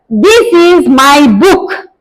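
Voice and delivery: slow, with "this" hit as the key word; teacher slowly modelling stress on "this". Shown as a demonstration. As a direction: lean on "this" and glide over "my". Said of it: "I have to stress".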